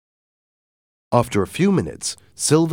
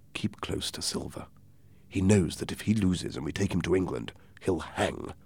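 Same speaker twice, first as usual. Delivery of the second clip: hushed confiding; 6 dB quieter than usual. Acted. Doctor secretly advising Jim, hushed. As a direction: drop the volume to confide.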